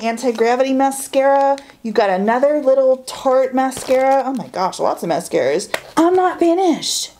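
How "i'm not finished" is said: high, playful announcement; teasing high exclaim. Real, not acted. Telling viewers the haul continues, high teasing exclaim. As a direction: squeal it like the fun isn't over.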